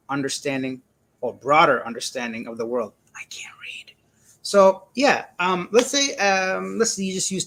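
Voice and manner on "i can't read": barely audible whisper; whispered aside. Real, not acted. Streamer owning up to a reading slip, whispered aside. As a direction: whisper it to yourself. Self-mocking aside after misreading.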